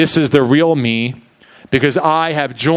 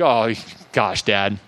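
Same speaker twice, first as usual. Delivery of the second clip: put-on lower, softer eye-rolling teen; unimpressed daughter; 5 semitones lower, 5 dB quieter, slower than usual. Older daughter brushing off her dad's monster story, softly dismissive. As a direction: sigh it out like a bored daughter. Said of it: "She wouldn't be like".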